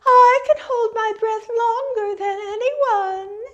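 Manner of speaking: very high, sustained loon call; Lady Loon. A narrator's reading. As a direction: boast on one long, high loon note. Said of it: "Then Lady Loon came forward".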